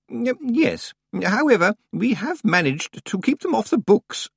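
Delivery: high, hesitant, distracted old librarian; Senior Librarian Swinsley. A narrator's reading. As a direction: mumble it while rummaging, fluttery and high.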